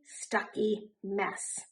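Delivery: usual speaking voice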